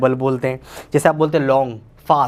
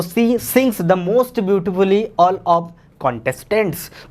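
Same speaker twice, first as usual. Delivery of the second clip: slow dictation; 4 semitones higher, much slower than usual. Shown as a demonstration. Teacher reads example sentence aloud.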